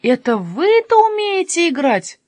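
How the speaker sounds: high, slow, incredulous question; can hardly believe it; utterly amazed. A narrator's reading. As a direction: draw it out in astonishment. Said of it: "Тут парикмахер совсем изумился".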